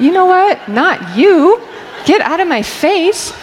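high, loud mock-annoyed retort; playfully irritated, pitched high and loud; annoyed snap. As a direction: snap back at him, half joking. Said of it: "I was so annoyed".

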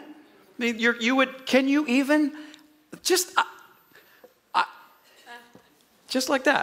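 high, halting stammer with long gaps; speechless amazement. Acted. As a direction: act out being too stunned to finish a sentence. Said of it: "that's how they sounded".

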